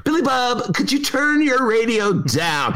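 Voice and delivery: high, slow polite host; like an old-time call-in host; radio host. Retold or imitated. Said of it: "old days in radio".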